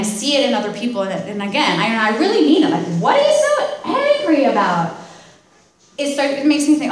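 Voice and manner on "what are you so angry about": high, loud, incredulous put-on voice; exasperated mock question. Sponsor mocking angry people she helps, high and incredulous. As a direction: toss it out like you can't believe their anger.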